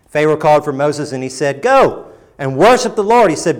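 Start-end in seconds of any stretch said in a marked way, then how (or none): 1.6-3.3 high, loud, lordly concession; Pharaoh's grudging offer. Acted. Preacher voicing Pharaoh conceding to Moses, raised and lordly. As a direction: grant it like a king doing a favour.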